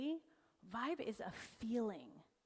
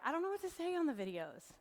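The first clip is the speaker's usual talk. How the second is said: higher, hurried whine of an excuse; mock complaint; 7 semitones higher, faster than usual. Speaker mimics business owners making excuses about video, mock complaint. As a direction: imitate a flustered client, pitched up and fast. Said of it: "if you're like".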